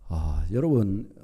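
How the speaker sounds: ordinary delivery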